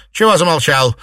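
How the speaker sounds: loud, high prodding question; impatient. A narrator's reading. Mistress prodding the silent servant, impatient. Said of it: "нетерпеливо спросила Фэнзе".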